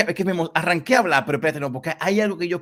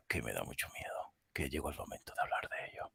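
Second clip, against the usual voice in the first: much softer, breathy eerie whisper; spooky whisper; 12 semitones lower, 17 dB quieter than usual. Pretend. Host whispering to Laura that the scary moment arrived, eerie hush. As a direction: hush it like a secret in the dark.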